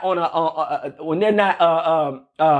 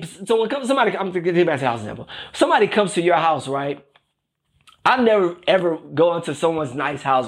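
ordinary talk

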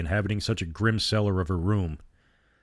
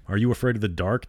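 ordinary delivery

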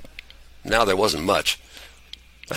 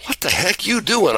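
hollering; 8 semitones higher than usual. A narrator's reading. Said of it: "I hollered with surprise".

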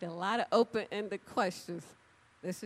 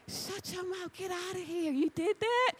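playful disbelief; 9 semitones higher than usual. Retold or imitated. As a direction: drag it out in fun disbelief. Mock amazement at coworker.

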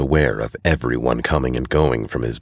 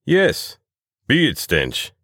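repeating; 12 semitones higher, much slower than usual. A narrator's reading. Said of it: "repeats the barkeep".

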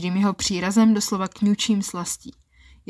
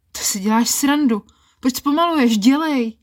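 frustrated demand; 5 semitones higher, 5 dB louder than usual. Acted. Frustrated she demands he continue.

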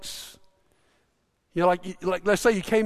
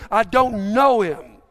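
louder, slow, emphatic retort, acting fed up; exasperated denial; 5 dB louder, slower than usual. Peter's third denial when questioned, exasperated. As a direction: deny it with drawn-out exasperation. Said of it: "he's so exasperated by the question, he curses".

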